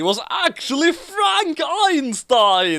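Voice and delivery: a dramatic announcement, half laughing; shouted reveal. Acted. Reading the absurd plot twist to a co-host, mock-dramatic shout. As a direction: bellow the twist, slowed down, barely holding it together.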